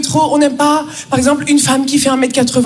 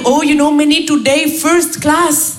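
put-on Indian accent; slower than usual. Raj arriving at work.

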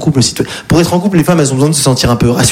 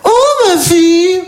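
put-on baby talk to a dog; 14 semitones higher than usual. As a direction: coo at the chihuahua like a baby. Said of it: "elle fait". (voix bébé très aiguë pour son chihuahua)

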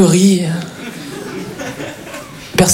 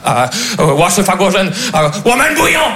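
made-up Chinese sounds, mock angry; angry fake Chinese. Actor bluffs a scene in pretend Chinese, mock-angry. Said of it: "Le chinois énervé".

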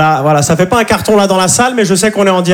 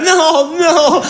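high squeaky refusal; 12 semitones higher than usual. Retold or imitated. Clerk refusing behind glass.

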